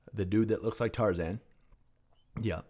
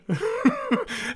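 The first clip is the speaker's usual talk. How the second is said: high burst of laughter; 6 dB louder than usual. Real, not acted. Laughing at yearly near-death.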